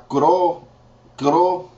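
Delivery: cro- started high and broken off twice; teacher trying the hard syllable; halting attempt. Shown as a demonstration. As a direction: stumble on the cro- sound. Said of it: "that's kinda hard to say".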